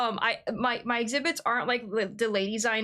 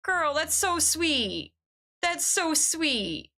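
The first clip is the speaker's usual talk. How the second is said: touched; 6 semitones higher, much slower than usual. Real, not acted. Touched by viewer kindness.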